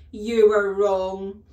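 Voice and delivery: loud, slow bark. Acted. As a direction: snap the verdict down hard and slow. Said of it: "the Trunchbull barked".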